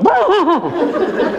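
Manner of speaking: real high-pitched laugh. Real, not acted. Speaker laughing at the kids changing oil. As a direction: burst out laughing.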